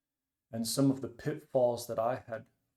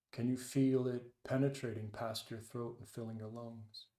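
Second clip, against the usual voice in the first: guided meditation. Acted. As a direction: ease them into the breath, unhurried. Leads a meditation.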